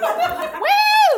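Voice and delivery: high, loud whooping laugh. Real, not acted. Laughing while retelling the chase.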